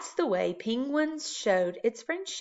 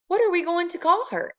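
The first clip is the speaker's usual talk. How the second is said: higher, louder wife's question; Mrs Popper's voice; 10 semitones higher, 5 dB louder than usual. A narrator's reading. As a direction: ask it up high like the wife. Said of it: "asked Missus Penguin".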